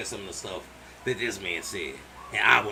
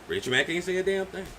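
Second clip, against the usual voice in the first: normal talking voice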